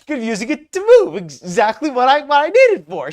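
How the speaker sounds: loud, high parroting; mocking imitation. Host parrots a scammer's excuse, mocking. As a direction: throw the words back in scorn.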